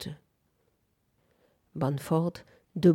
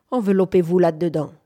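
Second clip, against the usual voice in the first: louder, quick command; brisk order; 6 dB louder, faster than usual. Acted. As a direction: hand it over briskly. Henry ordonne, la couverture. (ordre bref, plus fort)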